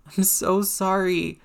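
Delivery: high, slow, anguished apology; voice cracking. A narrator's reading. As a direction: drag out a breaking, high-pitched apology. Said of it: "voice cracking halfway through the words".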